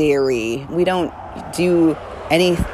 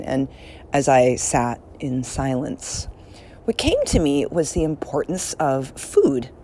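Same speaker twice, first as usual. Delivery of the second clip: normal talking voice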